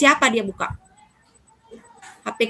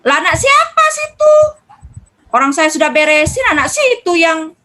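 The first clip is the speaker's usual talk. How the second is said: much higher, louder defensive comeback; snappy retort; 3 semitones higher, 5 dB louder than usual. Retold or imitated. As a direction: fire back indignantly. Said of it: "Pasti kan kita nyolot jadinya". (istri membalas suami dengan ketus, tinggi dan keras)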